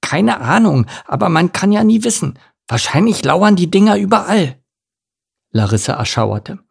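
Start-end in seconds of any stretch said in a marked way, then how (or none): 0.0-4.6 high-voiced worried little duck; raised character voice, earnest and uneasy; anxious duckling. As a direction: fret through the dangers as a young duckling.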